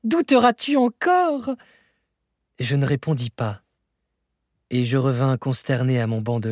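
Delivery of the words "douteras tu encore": high, slow gloating question; triumphant challenge. A narrator's reading. Uncle taunts his doubting nephew, slow gloating cry. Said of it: "s'écria mon oncle".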